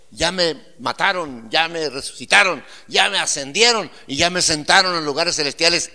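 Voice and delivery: full-voiced sermon declaration; preaching. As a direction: proclaim it to the church, building in fervor. Preaching to congregation.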